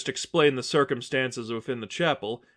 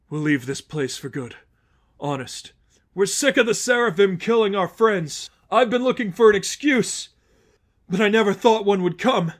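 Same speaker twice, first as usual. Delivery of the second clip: a hint of a shaken follower, rising higher; Lester, shaken relief; 8 semitones higher, 6 dB louder than usual. Lester confessing to Stephanie he wants to leave, shaken. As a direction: confess you wanted out.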